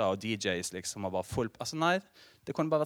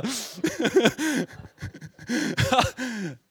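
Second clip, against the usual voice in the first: high, loud burst of real laughter; 13 semitones higher, 8 dB louder than usual. Real, not acted. Preacher cracking up at his own story, loud laugh.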